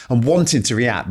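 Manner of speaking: normal talking voice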